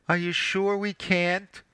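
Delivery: high, pleading wife's voice; pathetic plea. A narrator's reading. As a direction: coax him with a pitiful little ask. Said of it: "with a pathetic, searching look".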